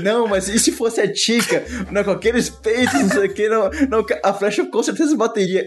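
flustered excuse. Retold or imitated. Quoting character's excuse, laughing.

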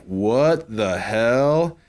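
slow drawn-out disbelief; stunned slow. Retold or imitated. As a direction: let it hang in shock. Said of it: "I was just like".

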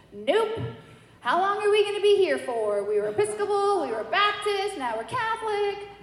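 high, whiny-style complaint; imitating fed-up children; kids' protest. Children's retold protest at converting.

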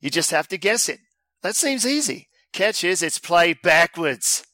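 put-on Australian accent; bad Australian accent. Host reading listener's quiz email, bad Australian accent. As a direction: read it in a hammy Australian voice. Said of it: "Maybe I'll do my Battle Strand accent".

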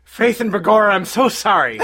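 stage-Irish apology; put-on Irish, playfully contrite; mock Irish accent. Immigrant apologizing to angry local, mock Irish accent. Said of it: "I think I turned Irish".

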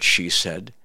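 ordinary talk, nothing marked

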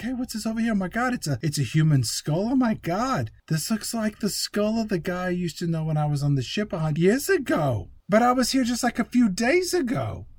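high, baffled bro impression; mock astonishment, rising into a puzzled whine; Mark Wahlberg. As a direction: play an amazed, dim bro. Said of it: "And he's like".

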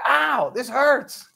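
high, slow mock yelp of pain. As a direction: play the shocked man in fake pain. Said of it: "starts screaming".

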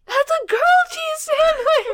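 excited shriek. Retold or imitated. Retelling a kid's outburst.

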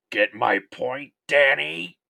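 slow taunting slasher, rising loud; Freddy Krueger voice. Acted. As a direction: sneer it like Freddy twisting the knife. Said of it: "my pseudo-best Robert Englund impression".

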